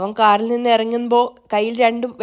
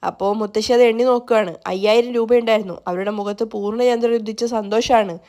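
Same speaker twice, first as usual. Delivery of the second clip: ordinary delivery, nothing marked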